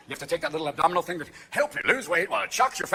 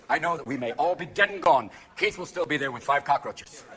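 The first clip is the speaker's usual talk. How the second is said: normal talking voice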